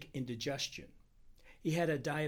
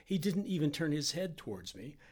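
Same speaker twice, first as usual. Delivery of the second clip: ordinary delivery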